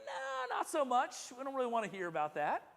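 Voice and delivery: a high, snooty "No" then quick brush-off; mock dismissive refusal. British crown refusing colonists, mock dismissive. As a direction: wave the colonists away like a bored ruler.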